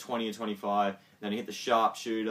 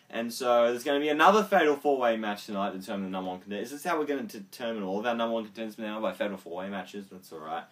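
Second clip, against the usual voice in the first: ordinary talk, nothing marked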